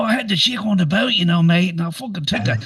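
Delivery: put-on high, fast Aussie bloke voice, thick Australian accent; heavy street Australian accent. Retelling an Aussie guy on a boat, in a heavy Australian accent. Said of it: "The accent was so heavy".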